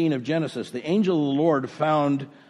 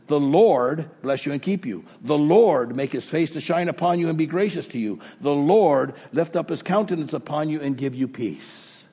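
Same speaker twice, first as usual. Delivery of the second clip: each line opens with a weighted "The Lord"; a pastor stressing the Lord in the blessing; 3 dB louder than usual. Shown as a demonstration. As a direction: lean on "The Lord" every time. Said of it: "but the emphasis is".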